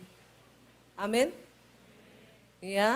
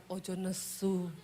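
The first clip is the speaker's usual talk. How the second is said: slow, much softer Javanese "Ojo nesu"; calm him down, lower and softer; soft Solo husband; 5 semitones lower, 11 dB quieter, slower than usual. Retold or imitated. Husband soothing his wife, soft slow Javanese. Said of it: "Dia jawab".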